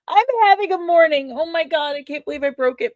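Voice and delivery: high, loud distraught venting; exasperated outburst. Real, not acted. Host can't believe she broke it, exasperated outburst. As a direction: burst out, then ease down slightly. Distraught over breaking her mug.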